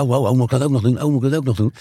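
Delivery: rattled off fast in mock panic; a quick, overwhelmed whine at an extra meeting; flustered mock complaint. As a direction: gabble it like a harried office worker. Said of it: "Dus kun je ook zeggen".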